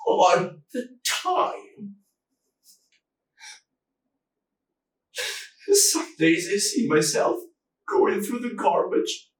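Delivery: high, anguished, halting confession; tearful remorse. Acted. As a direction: hold back tears as the guilt rises. Haunted by the lie, near tears.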